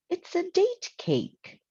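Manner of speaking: the aunt pipes up, very high, sure she's figured it out; high-voiced aunt, pleased to have worked it out; Aunt Myra's voice. A narrator's reading. Aunt Myra voiced recognizing date cake.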